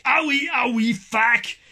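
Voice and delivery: high, loud catchphrase in mock Québécois; hammed-up shout. Promising viewers they will enjoy it, high hammed-up shout. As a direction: yell it out like a running gag.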